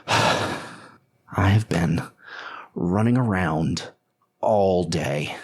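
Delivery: worn out. Acted. Worn-out character finally sits down.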